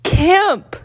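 high mock-anguished cry; dramatic wail. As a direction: wail the word like a tragedy. Dramatic topic reveal.

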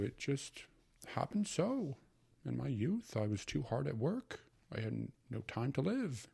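put-on high, hearty old uncle. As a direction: play the fussy old uncle. Uncle's voice in story.

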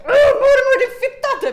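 very high, loud exasperated shriek; mock furious scream. Retold exasperated cursing of grandmother. (spelat ursinnigt skrik)

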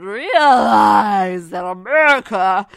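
loud, drawn-out mock bombast; hammed-up patriotic bellow. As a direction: stretch it out and belt it like a parody anthem. Hammed-up patriotic boast about America.

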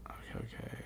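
soft unvoiced whisper. Real, not acted. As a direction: murmur it under your breath. Whispered reaction.

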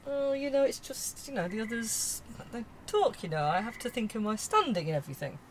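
nervously fussing about her standing, lightly voiced; worried posh lady. Q P fretting about the neighbours gossiping, softly posh. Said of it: "I suddenly felt very Penelope Keith".

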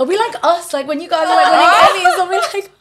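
laughing. Real, not acted. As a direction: tease them while laughing. Joking while laughing at friends.

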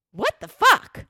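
loud, high burst of indignation; outraged outburst. Retold or imitated. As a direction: retell it as an indignant exclamation. Indignant reaction to room service refusal.

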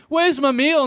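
a mock of a drunk friend at the caravan; drunk mate demanding. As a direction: whine it like a drunk who wants his food. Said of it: "and you were going".

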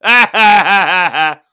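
loud braying ha-ha laugh; loud mocking guffaw. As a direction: bray it out like a bloke in a van. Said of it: "I hear him going".

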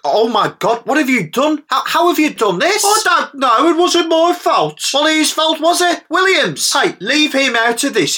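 high, frantic squabbling; heated bickering. Acted. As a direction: snipe back in panic. Heated squabble over sat nav.

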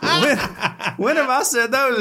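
high-pitched mock protest of innocence; indignant disbelief. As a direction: act out a caught-out parent, voice shooting up. Dad protests child's accusation.

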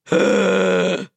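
long, exaggerated gasp; mock alarmed gasp. Host contrasts calm noticing with panic, mock alarmed gasp. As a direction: gasp as if everything must stop. Mimes alarm, rather than curiosity.

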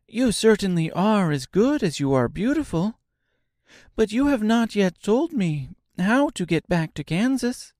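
put-on high, eager little girl; sweet child asking earnestly; young girl voice. A narrator's reading. Dorothy speaking.

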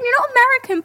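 ordinary delivery

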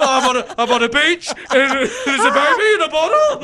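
high, laughing mock-dramatic. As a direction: giggle through it. Joking Death Stranding quip.

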